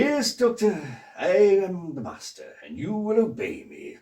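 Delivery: suave, commanding villain voice, rising high on key words; the Master. Shown as a demonstration. Performs his Master impression on request, grand villainous command. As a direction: draw it out grandly, like a hypnotic villain. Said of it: "your master impression".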